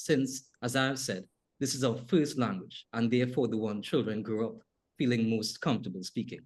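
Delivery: usual speaking voice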